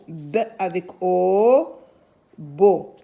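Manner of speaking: teacher spelling aloud slowly; slow spelled out. Shown as a demonstration. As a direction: sound it out for small children. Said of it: "on va écrire la syllabe beau".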